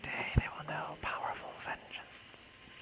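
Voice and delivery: slow sinister whisper, barely voiced; menacing villain whisper. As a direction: hiss the threat like a dark sorcerer.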